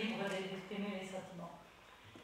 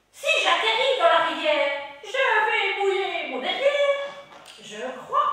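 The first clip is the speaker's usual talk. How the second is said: high, loud laughing witch; 11 semitones higher, 16 dB louder than usual. Acted. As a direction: shriek it like a giggling witch. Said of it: "une voix de sorcière".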